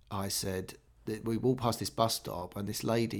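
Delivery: ordinary talk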